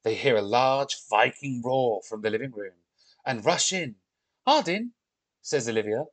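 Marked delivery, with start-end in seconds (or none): 4.5-4.9 high, rising call; excited call. Acted. Olivia rushing in after the roar, high surprised call.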